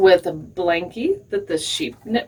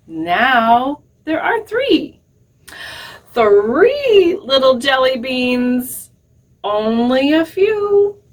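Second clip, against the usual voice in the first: put-on sing-song read-aloud for little kids, lingering on each count; storytime rhyme reading; 3 semitones higher, slower than usual. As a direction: count the jelly beans down like a picture book. Reads rhyme to children.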